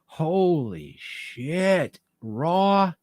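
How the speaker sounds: drawn-out, slow awe; slow awed. Real, not acted. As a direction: stretch it out in wonder. Awed recollection.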